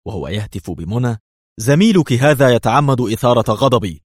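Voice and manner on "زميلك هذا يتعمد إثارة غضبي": loud, higher, openly angry; irritated, raised-voice complaint; angry call. A narrator's reading. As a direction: call out with anger plain in the voice. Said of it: "شديد الوضوح في صوته وهو يهتف".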